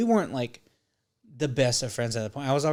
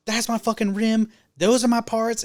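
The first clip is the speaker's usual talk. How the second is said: higher, louder indignant yell; retold shouting; 11 semitones higher, 6 dB louder than usual. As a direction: shout it at the dad like it's your stuff. Shouting at Travis's dad.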